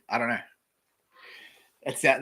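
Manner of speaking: ordinary delivery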